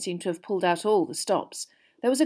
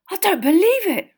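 high, loud astonished outburst; stunned boy, pitch shooting up; 13 semitones higher, 9 dB louder than usual. A narrator's reading. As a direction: gasp it out in amazed disbelief. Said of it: "in a stunned voice".

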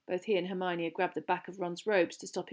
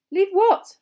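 high, loud, baffled question; bewildered; 12 semitones higher, 13 dB louder than usual. A narrator's reading. Harry confused by the teacher's order, bewildered. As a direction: ask it baffled, pitch jumping up. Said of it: "Harry said, bewildered".

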